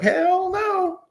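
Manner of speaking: very high, tiny dog voice, panicked; the little Chihuahua refusing the collar, in a squeaky high voice. Retold or imitated. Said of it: "he was like".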